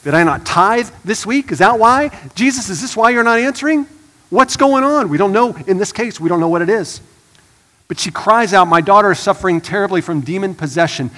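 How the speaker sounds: loud, high, driving sermon voice; rising to full preaching force. Acted. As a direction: preach it hard to the congregation.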